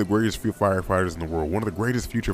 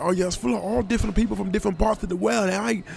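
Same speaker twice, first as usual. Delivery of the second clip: high-pitched mock Australian woman; terrible Australian accent; 12 semitones higher than usual. Man quoting Australian woman on diversity, high terrible Australian accent. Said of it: "very, very terrible Australian accents".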